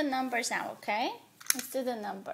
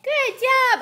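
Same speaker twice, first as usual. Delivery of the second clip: high, loud praise; excited praise; 11 semitones higher, 11 dB louder than usual. Real, not acted. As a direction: cheer the little one on. Praising toddler's counting.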